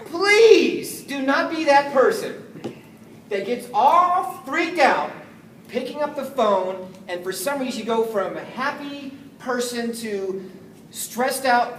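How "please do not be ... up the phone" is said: drawn-out, every word pressed home; slow it right down to warn them; deliberate slow warning; slow emphatic plea. Real, not acted.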